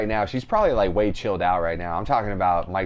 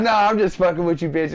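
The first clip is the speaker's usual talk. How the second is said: loud, higher brush-off; joking mom impression; 8 semitones higher, 5 dB louder than usual. Friend imitates mom admitting the prank, loud teasing. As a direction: toss it out as her loud teasing reveal.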